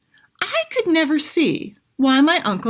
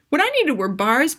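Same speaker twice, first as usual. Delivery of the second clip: ordinary delivery, nothing marked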